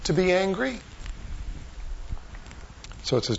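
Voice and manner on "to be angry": higher, softened voice, searching question; patient acted reproof, kept gentle. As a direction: ask it tenderly, letting it rise at the end. God gently questions angry Jonah.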